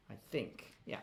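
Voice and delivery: usual speaking voice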